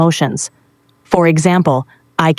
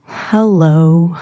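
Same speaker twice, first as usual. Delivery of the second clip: sad. Shown as a demonstration. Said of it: "you're really sad".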